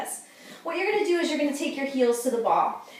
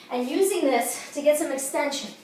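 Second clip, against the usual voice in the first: ordinary talk, nothing marked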